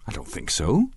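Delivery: plain reading voice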